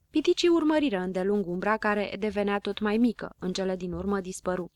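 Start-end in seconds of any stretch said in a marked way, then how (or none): none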